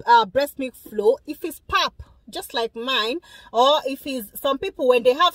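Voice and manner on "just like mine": ordinary talk, nothing marked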